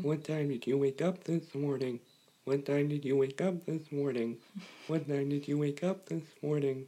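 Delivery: soft, monotone computer speech; robot voice. Acted. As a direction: repeat it identically, like a quiet machine. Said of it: "that's what a robot would sound like".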